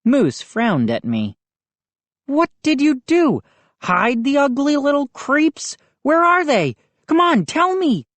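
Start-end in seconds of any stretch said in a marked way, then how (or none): 2.3-8.1 higher, pushy bully voice; bully demanding. A narrator's reading. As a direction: push him, demand an answer.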